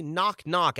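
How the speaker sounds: high, self-mocking announcement; meek spoken knock. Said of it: "out loud".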